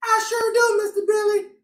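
squeaky puppet buddy gushing over the book. Acted. Puppet Busy answers host.

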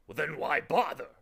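loud alarmed question. A narrator's reading. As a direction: sound the alarm. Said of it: "alarm was suddenly ringing".

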